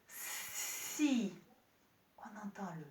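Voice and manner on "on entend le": put-on whispered hint to children. Shown as a demonstration. Teacher whispering the answer to help the class. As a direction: softly breathe the s. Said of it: "Qu'est-ce que vous entendez".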